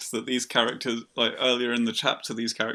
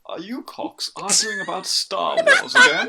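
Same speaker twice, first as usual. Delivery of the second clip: posh bastard voice; 7 semitones higher, 4 dB louder than usual. Acted. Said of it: "posh bastard voice".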